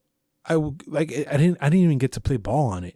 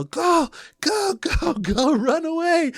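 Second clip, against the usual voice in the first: high excited yelling, much higher; slower than usual. Retold or imitated. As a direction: urge the cousin to flee, shouting high. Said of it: "and we yell".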